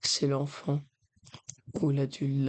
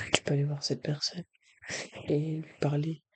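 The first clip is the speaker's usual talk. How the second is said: ordinary talk, nothing marked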